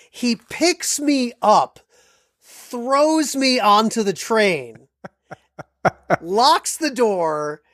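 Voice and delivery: very slow, incredulous blow-by-blow; dramatic slow build. Real, not acted. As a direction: stretch out each action for comic drama. Dramatic climax of his story.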